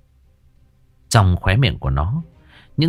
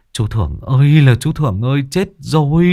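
loud, higher frantic cry; panicked calling; 5 semitones higher, 3 dB louder than usual. A narrator's reading. Thiên running upstairs, crying out for Thưởng in panic. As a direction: call out in panic.

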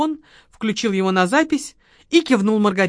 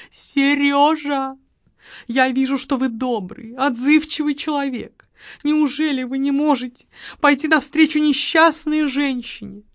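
higher, coaxing woman's voice; sugary plea for sympathy; pleading widow; 7 semitones higher, 5 dB louder than usual. Acted. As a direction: wheedle him like a helpless widow. Margarita pleading with the marquis.

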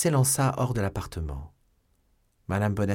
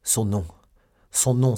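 louder exclamation; urgent demand; 2 semitones higher, 6 dB louder than usual. A narrator's reading. Young man demanding the woman's name, urgent cry. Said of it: "s'écria D'Artagnan".

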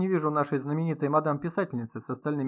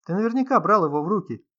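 loud, raised-pitch challenge; agitated; 6 semitones higher, 7 dB louder than usual. A narrator's reading. Artyom accuses the host over fingerprints, agitated. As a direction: build into a higher, louder accusation. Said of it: "заволновался вдруг Артём".

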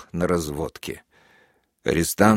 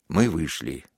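ordinary delivery, nothing marked

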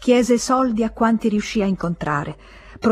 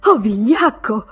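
louder, contemptuous cry; shouted insult; 3 semitones higher, 5 dB louder than usual. Acted. Emma to Léon when he hesitates, shouting in contempt. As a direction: throw the insult at him. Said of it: "gridò".

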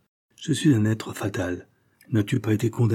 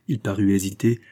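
plain reading voice

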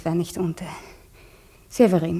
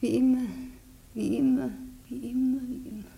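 repeating it softer and softer until it's a whisper; tearful, fading to a whisper; 3 semitones higher, 4 dB quieter, faster than usual. Acted. Elisa fades into tearful whispers as the necklace sticks. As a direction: let the tears take the voice away. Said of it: "Du weinst?".

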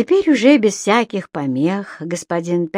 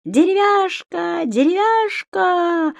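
high, slow ecstatic chant; rapturous cry; 11 semitones higher, slower than usual. A narrator's reading. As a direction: relish each word, drawn out and high. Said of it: "упоенно кричал он". (восторженный высокий протяжный возглас)

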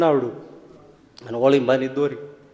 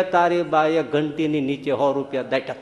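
ordinary talk, nothing marked

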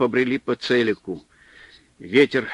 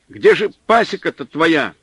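raised, irritated call into the wind; irritated shout; 5 semitones higher, 6 dB louder than usual. A narrator's reading. Supply man shouting at Pavel, irritated. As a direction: snap at him, openly annoyed. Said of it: "не скрывая раздражения, крикнул".

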